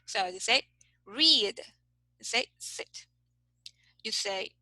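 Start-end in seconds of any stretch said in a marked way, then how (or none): 1.1-1.6 "read" with a long, held ee; long vowel. Shown as a demonstration. Teacher repeats the longer example, long ee in "read". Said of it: "This is longer". 2.6-3.1 "sit" with a short, quick i; short vowel. Shown as a demonstration. Teacher repeats the shorter example, short i in "sit". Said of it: "and this is shorter".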